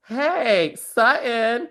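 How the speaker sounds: high-pitched, drawn-out mock questioning; imitating Amanda. Castmate quoted asking Sutton about yesterday at dinner, high mock voice. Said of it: "Amanda, for the second night at dinner, goes".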